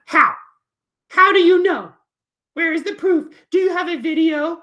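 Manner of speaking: high, loud demanding kitty; puppet cat voice. Acted. Cat puppet demands proof from teacher, high and loud. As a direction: challenge him in a squeaky cat voice.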